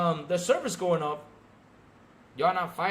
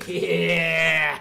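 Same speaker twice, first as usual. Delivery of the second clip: hearty laugh; 7 dB louder than usual. Real, not acted. Laughs at fans.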